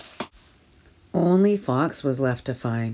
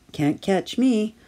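high, slow playful shout; 8 semitones higher, 4 dB louder than usual. Acted. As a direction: call out teasingly from the hiding place. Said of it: "he shouted".

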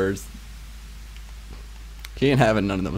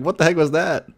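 startled reaction; 8 semitones higher, 4 dB louder than usual. Retold or imitated. Acts out startled reaction to fireworks.

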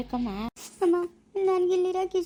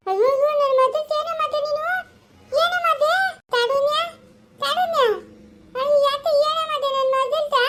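shrieking, much higher and louder, anguished; panicked wailing; 11 semitones higher, 5 dB louder than usual. Acted. Mother finding her daughter collapsed, wailing in panic. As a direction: cry out for your daughter in terror.

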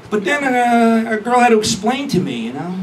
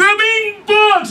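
mock panicked shriek; 11 semitones higher than usual. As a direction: squeal it like a girlfriend panicking over bugs. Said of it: "She says".

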